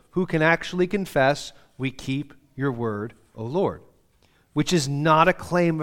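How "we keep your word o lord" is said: softer, slow, reverent confession; humble sincere. Shown as a demonstration. Pastor showing how to read the verse, softly reverent. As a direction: offer it gently, like a prayer. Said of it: "It really matters how you read it".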